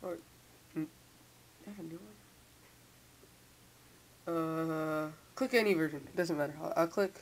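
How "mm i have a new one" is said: ordinary talk, nothing marked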